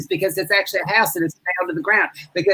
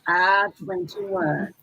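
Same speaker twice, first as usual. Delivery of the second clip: slow, quieter repeat of the call; bingo call, softer; 5 dB quieter, much slower than usual. Acted. Bingo host repeats the ball softly. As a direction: drawl the number softly again. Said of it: "B one".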